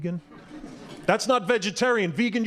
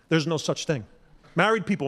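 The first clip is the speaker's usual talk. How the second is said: usual speaking voice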